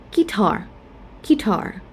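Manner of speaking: guitar stressed on TAR, falling; stress on second syllable. Shown as a demonstration. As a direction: say guitar as gui-TAR, long second vowel. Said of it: "we stress in the second syllable".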